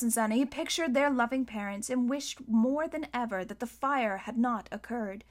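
ordinary delivery

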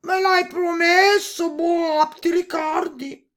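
high, loud, drawn-out toad cry; pleading toad. A narrator's reading. Toad reminding the princess of her promise, high pleading croak.

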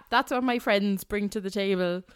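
ordinary talk, nothing marked

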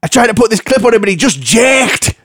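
high, hammed-up dog owner; comic high-pitched woman's voice; imitated older woman. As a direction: plead an excuse in a mock high voice. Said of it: "She went".

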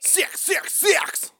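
high, loud shout of six. Acted. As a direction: belt out six, six, six. Shouting the number of the beast.

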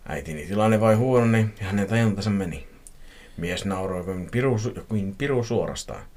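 ordinary delivery

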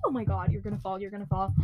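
usual speaking voice

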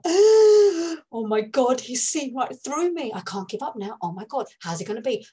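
high shocked oh my God, then fast fretting; retold shock. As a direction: panic at being seen through.

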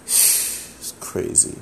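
whispered, very slow; disbelieving whisper. Real, not acted. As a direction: breathe it out in disbelief. Stunned reaction.

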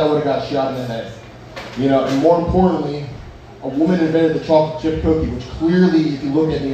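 ordinary talk, nothing marked

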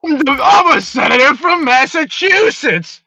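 high, slow mock Boston senator; Boston accent. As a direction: keep the hammed-up Boston voice going. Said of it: "Boston accent".

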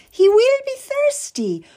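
sweet as honey, with a barb; sweetly. Acted. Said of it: "smiled sweetly at him".

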